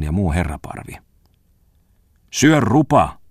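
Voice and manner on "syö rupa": high, loud snapped insult; angry snap. A narrator's reading. Bongman rounds on his rival Tommola, snapping angrily. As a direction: snap it sharply, voice leaping up. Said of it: "tiuskaisi maisteri Bongman Tommolalle".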